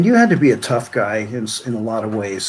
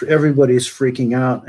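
ordinary delivery

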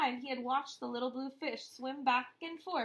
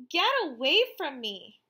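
angry shout; 3 semitones higher, 8 dB louder than usual. Acted. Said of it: "cried Rainbow Fish".

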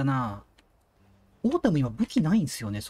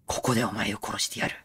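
menacing villain voice; 6 semitones lower than usual. Pretend. Villain line, low voice.

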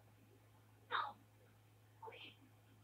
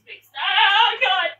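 loud frightened plea; panicked shout; 14 dB louder, slower than usual. Real, not acted. Panicking during horror game.

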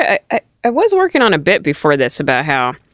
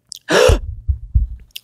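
imitated gasp; 11 semitones higher than usual. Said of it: "you can hear him go".

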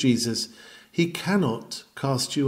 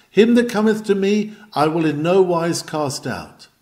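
ringing, raised preacher's proclamation; preaching the text; 3 semitones higher, 3 dB louder than usual. Acted. Preacher proclaiming the promise to sinners, ringing. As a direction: proclaim the promise high and loud.

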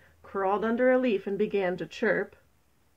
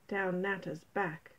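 usual speaking voice

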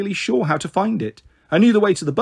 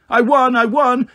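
higher and louder triumphant cry; 8 semitones higher, 4 dB louder than usual. Acted. Boy crowing to his sisters that he won, triumphant and loud. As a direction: crow about winning the race. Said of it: "scarlet and triumphant".

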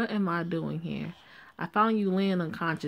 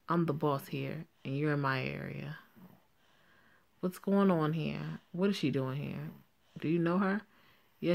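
usual speaking voice

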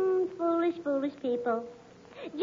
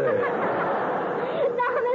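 high giggling laugh; coy giggle; 8 semitones higher, 4 dB louder than usual. Real, not acted. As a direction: laugh it off coyly. Giggling at caller's flirting.

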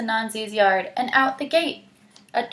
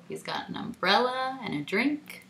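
ordinary delivery

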